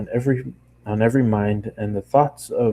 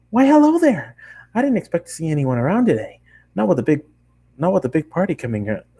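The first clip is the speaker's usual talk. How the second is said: much higher, fast and bubbly; excited pony girl; 7 semitones higher, faster than usual. Acted. Bakery girl chattering to the lone traveler, high and fast. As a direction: rattle it off like an overjoyed party pony.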